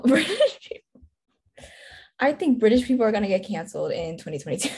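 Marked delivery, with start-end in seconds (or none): 0.0-1.0 laughing repeat. Real, not acted.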